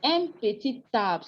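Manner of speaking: "Un petit arbre" with T linked, peti-tarbre; with liaison. Shown as a demonstration. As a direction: sound the t of "petit" at the start of "arbre". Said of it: "the T and the A gives the ta sound".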